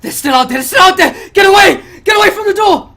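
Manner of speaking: high, loud panicked yell, building; terrified survivor shouting through a door. Acted. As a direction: scream at them to get away. Game master voices panicked survivors shouting.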